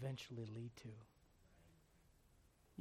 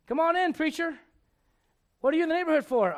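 higher and louder, booming host; hearty welcome; 11 semitones higher, 13 dB louder than usual. Retold or imitated. David welcoming Nathan, booming cheerful greeting. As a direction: greet the preacher big and cheery.

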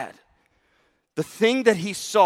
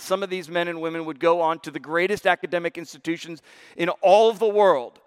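normal talking voice

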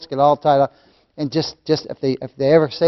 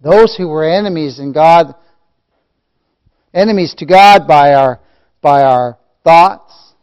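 loud, measured sermon delivery; forceful preaching; 11 dB louder than usual. Real, not acted. Preacher reminding believers they were God's enemies, forceful preaching. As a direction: press hard on each phrase, stretching the last.

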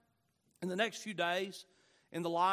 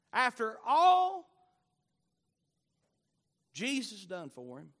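ordinary delivery

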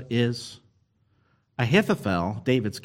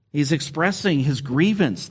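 normal talking voice

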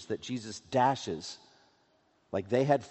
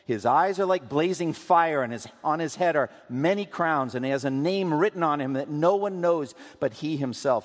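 loud, building scripture reading; scripture proclamation; 4 semitones higher, 6 dB louder than usual. Acted. Preacher reading Revelation to the congregation, forceful proclamation. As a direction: proclaim it with mounting force, voice raised.

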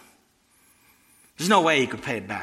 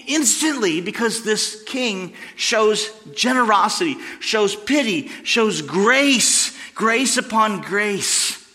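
building to a higher, louder preaching climax; 5 semitones higher, 3 dB louder than usual. Acted. Preacher proclaiming God's grace to his congregation, at full force. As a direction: lift the room with rising praise for grace.

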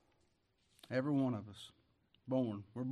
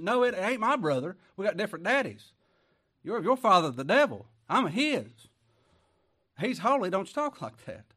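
louder, higher preaching shout; fiery rebuke; 6 semitones higher, 9 dB louder than usual. Real, not acted. Preacher rebuking the false brethren, shouting. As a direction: hit it hard like a rebuke from the pulpit.

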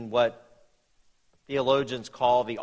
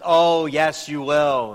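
knowing contradiction; 3 semitones higher, 7 dB louder, slower than usual. Retold or imitated. Friend laughing off the young man's vow, knowing and louder. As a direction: tease him like you know better.

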